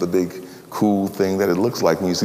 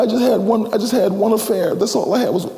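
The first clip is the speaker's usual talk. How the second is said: higher, pleading woman's voice; defensive woman; 11 semitones higher than usual. Acted. Woman at bus stop excusing her affair, higher and pleading. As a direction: plead your small excuse.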